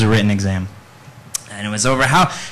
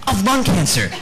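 ordinary delivery

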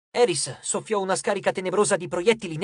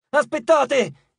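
high, loud, drawn-out shout; alarmed goddess calling a halt; panicked cry; 9 semitones higher, 6 dB louder, much slower than usual. A narrator's reading. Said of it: "gridò Nyx, colta all'improvviso dal panico".

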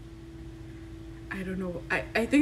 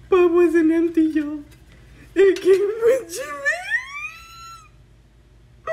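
loud, high, excited build to a scream; real fan scream, stretching the name; 8 semitones higher, 7 dB louder than usual. Real, not acted. Fan revealing the tin wasn't empty, screaming with joy. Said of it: "Are you screaming?".

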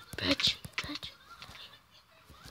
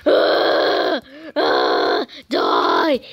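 battle cry; much slower than usual. Real, not acted. Battle cry while attacking.

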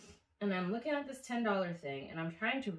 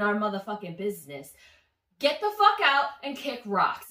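retold telling-off; 3 semitones higher, 7 dB louder than usual. Narrator to intruder at brunch, indignant telling-off. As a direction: tell them off, punching the opening words.

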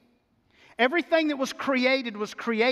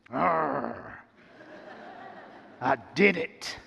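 low, satisfied growl of triumph; proud grass-cutter; 7 semitones lower, slower than usual. Acted. Preacher acting out finishing the lawn, low proud growl. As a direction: growl it low like a job conquered. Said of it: "feeling of accomplishment you get".

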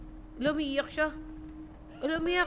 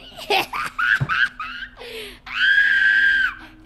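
delighted squealing laugh; 6 dB louder than usual. Real, not acted.

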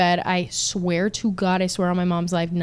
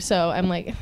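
ordinary talk, nothing marked

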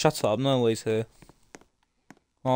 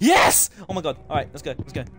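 high triumphant shrieking; shrieking jackpot winner; 5 semitones higher than usual. Real, not acted. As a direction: scream with joy like a winner. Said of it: "why am I screaming so badly".

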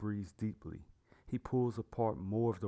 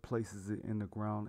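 plain reading voice

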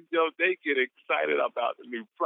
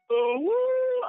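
excited woo. Real, not acted. Cheering colleague on call.

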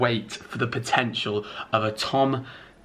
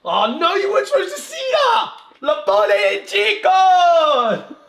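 very high, loud mock-alarm yell; shouted outburst; 9 dB louder, slower than usual. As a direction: shriek it, then drag out the last cry. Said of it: "Is that what you shouted up there?".

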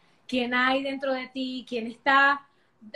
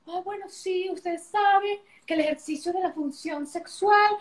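higher, slow, lightly put-on lecturing voice; stiff formal sexologist; 6 semitones higher, 3 dB louder than usual. A hint of the stuffy sexologist she tried to be for her videos. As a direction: mimic a dry textbook expert. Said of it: "quise ser la sexóloga".

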